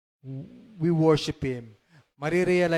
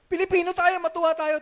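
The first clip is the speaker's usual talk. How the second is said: higher, excited teasing reply; excited retort; 11 semitones higher, 4 dB louder than usual. Retold or imitated. Pastor retelling what he told his child, excited. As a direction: tease the child for not cheering. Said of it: "sabi ko sa kanya".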